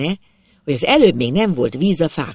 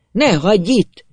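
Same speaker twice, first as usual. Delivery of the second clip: loud, raised-pitch plea; full-throated shout; 5 semitones higher, 5 dB louder than usual. A narrator's reading. Old man begging not to be left, full-throated shout. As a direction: shout desperately after the departing rider. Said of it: "harsogta torka szakadtából az öreg".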